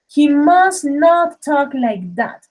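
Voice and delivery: slow, deliberate model sentence; slow teacher model reading; slow demo. Shown as a demonstration. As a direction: read it out slowly for the class. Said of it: "For example".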